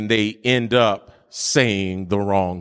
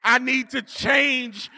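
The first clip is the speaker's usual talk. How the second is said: fiery preaching; 12 semitones higher than usual. Acted. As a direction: preach it out to the whole church. Preaching at full force.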